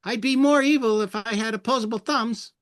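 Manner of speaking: high, loud cartoon villain bunny; evil bunny voice. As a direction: squeak it out like an evil rabbit. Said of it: "He would say stuff".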